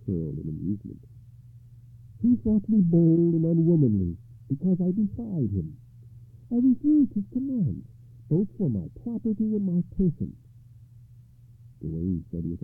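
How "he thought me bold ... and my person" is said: high, proud defiant voice; defiant lady. Acted. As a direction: say it proudly, unbowed. Ragnall defiant.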